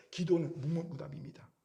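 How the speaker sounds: usual speaking voice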